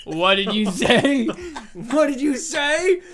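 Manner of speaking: slow, loud menacing challenge; hammed-up tough guy confronting someone; Kevin James. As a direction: draw it out as a mock threat. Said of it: "it is just Kevin James".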